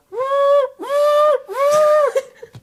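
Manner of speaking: loud, very high repeated whistle hoots; imitated whistle blasts. Mocking the captain's way of summoning, imitated whistle blasts. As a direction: hoot like an obnoxious whistle, loudly. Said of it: "You'd have to be like".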